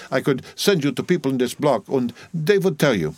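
put-on insistent, businesslike Dutch-accented woman; Dutch midwife, persuasive.

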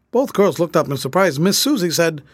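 ordinary delivery, nothing marked

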